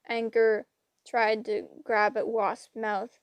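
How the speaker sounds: plain reading voice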